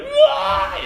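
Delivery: sudden high, loud wailing cry; mock bawling wail. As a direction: burst into a hammed-up bawl. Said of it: "just bawling".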